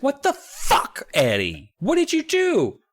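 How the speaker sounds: very high, drawn-out mock outrage; exasperated scolding. Sue turning on Eddie, acted as high-pitched exasperation. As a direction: scold him in an incredulous high voice.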